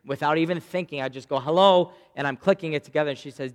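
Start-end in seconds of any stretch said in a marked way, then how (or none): 1.4-1.9 hurried hello. Retold or imitated. Answering phone while buckling kids.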